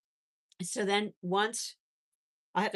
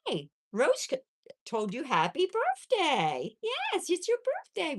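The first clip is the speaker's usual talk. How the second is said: high-pitched birthday cooing; pet talk; 10 semitones higher than usual. Real, not acted. Owner wishes her guinea pig happy birthday, cooing. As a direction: coo high and drawn-out, as to a little animal.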